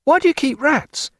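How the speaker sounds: high, baffled question; astonished cry. A narrator's reading. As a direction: ask it high in disbelief. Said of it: "cried Pullings".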